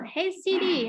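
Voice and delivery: high, slow call with a falling end. Shown as a demonstration. Teacher demonstrates the example to students, calling out. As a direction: stretch it out to hail someone.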